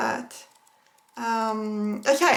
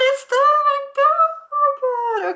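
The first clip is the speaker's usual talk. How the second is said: overwhelmed squeal; 5 dB louder than usual. Real, not acted. Overwhelmed opening the gift.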